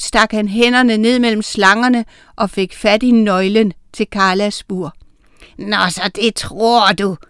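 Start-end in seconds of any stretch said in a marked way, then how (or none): 5.6-7.2 hissed, menacing witch voice; sneering hiss. A narrator's reading. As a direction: hiss it at someone you have caught out. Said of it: "hvæsede Oda".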